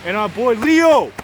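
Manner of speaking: high, loud shout-out; hyped sign-off. Real, not acted. Vlogger signing off to viewers, hyped. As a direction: belt out the crew's sign-off with swagger.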